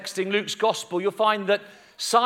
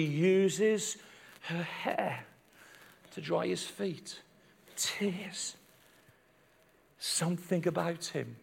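very soft, near whisper; intimate, halting storytelling; hushed reverence; 3 semitones lower, 10 dB quieter, slower than usual. A narrator's reading. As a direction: let each phrase fall gently into silence. Tender moment told in a hush.

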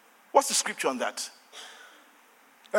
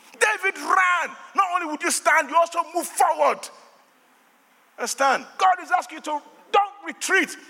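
high, emphatic sermon delivery; fired-up preaching; 10 semitones higher than usual. Real, not acted. Preacher urging church not to retreat, high forceful preaching. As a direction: drive it home to the congregation.

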